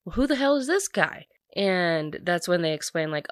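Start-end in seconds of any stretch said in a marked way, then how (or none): 0.0-1.3 a higher, brash mock-blunt demand; a hint of a tactless mother; blunt mom. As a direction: jab it at the stranger without tact. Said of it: "Her mom is just very blunt".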